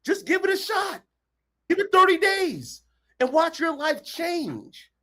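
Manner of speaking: high, urgent encouragement; rallying push. Real, not acted. Coach urging listeners to try thirty days, rousing. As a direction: fire them up to act.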